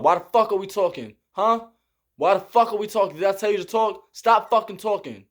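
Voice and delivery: fast, clipped mock scolding; imitating angry drill sergeant. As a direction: chew out the recruits like a drill sergeant. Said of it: "That's how he talk".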